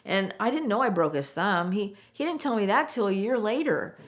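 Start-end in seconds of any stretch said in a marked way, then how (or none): none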